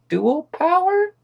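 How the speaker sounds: drawn-out, sharply rising mock question; a slow, dubious upward lilt; sarcastic question. Real, not acted. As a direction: let it hang, slow and skyward, sceptical. Mocking the vague proposal.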